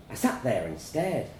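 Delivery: ordinary delivery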